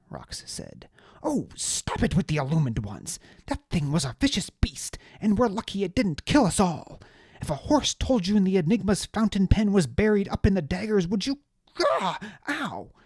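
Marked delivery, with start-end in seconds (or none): 1.2-12.9 high, loud, outraged aristocrat ranting; pompous little lord, higher and louder, furious; indignant high-voiced noble. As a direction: spit out the betrayal in a high indignant voice. Deliritus voice.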